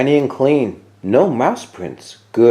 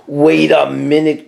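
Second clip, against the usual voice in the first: growled; 3 semitones higher, 4 dB louder than usual. A narrator's reading. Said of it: "growled Bear".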